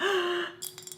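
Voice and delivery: high, delighted gasp; exaggerated intake of breath; excited gasp. Real, not acted. Showing off new cup.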